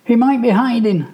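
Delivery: put-on high, loud fearful boy's voice; scared boy. A narrator's reading. Michael warns his brother, scared and loud. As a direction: insist it in fear. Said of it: "Michael says".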